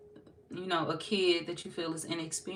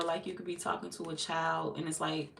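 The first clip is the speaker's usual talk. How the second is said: ordinary talk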